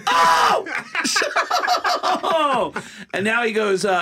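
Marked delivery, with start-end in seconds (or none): 0.1-3.1 long screamed "oh"; comic's signature full-throated scream; Sam Kinison. Retold or imitated. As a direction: let out a huge, high, drawn-out yell. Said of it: "now I'm screaming at him".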